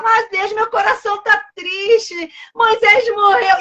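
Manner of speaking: high, slow whiny plea; hammed-up tearful protest; mock lament. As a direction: complain to God like a heartbroken Joshua. Acts Joshua whining to God.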